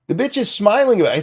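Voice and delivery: high, fast, indignant disbelief; squeaky-high indignation; incredulous outrage. Real, not acted. Outraged reaction to her smiling.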